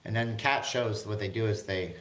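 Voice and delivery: ordinary delivery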